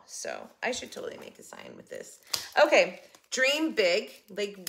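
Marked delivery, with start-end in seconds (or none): 0.1-2.2 hushed, unvoiced remark; whispered aside. Real, not acted. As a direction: murmur it to yourself. Muttered aside.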